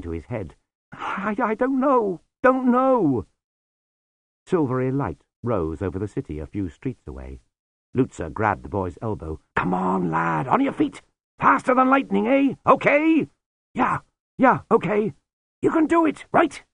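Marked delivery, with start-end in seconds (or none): 0.9-3.3 high, stammering panic; panicked. Acted. Lobsang panicking, voiced. 9.6-16.6 high, louder urging; rally him hard; urgent encouragement. Acted. Lutzer urging boy on.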